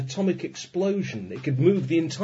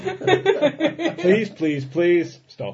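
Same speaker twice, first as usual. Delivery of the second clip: laughing plea; 4 semitones higher, 4 dB louder, much slower than usual. Real, not acted. Begging to end telegram joke.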